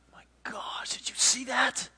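acted breath-taken amazement; awestruck. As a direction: react in stunned half-whisper to a miracle. Sailors stunned as storm stops.

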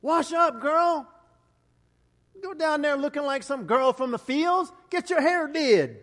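high, loud motherly bossing; Naomi's urging. Acted. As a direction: boss her like an older woman getting a girl ready. Naomi voiced urging Ruth.

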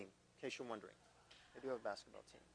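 a soft under-the-breath afterthought; quiet aside. Real, not acted. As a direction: drop the voice to a hushed aside.

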